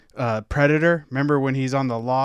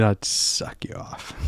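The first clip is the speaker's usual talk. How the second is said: whispered quote; 3 semitones lower, 3 dB quieter, slower than usual. Retold or imitated. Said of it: "going up and down the line saying".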